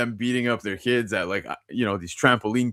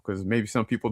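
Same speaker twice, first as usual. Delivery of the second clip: ordinary talk, nothing marked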